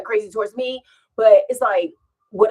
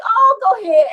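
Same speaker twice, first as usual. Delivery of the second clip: excited cheer; 14 semitones higher, 9 dB louder than usual. Retold or imitated. Cheering on the young man.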